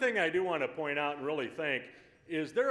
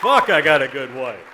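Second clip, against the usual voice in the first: a loud, delighted outburst, noticeably lower; loud exclamation; 5 semitones lower, 13 dB louder than usual. Real, not acted. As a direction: blurt it out, proud of the wife. Husband after wife's speech.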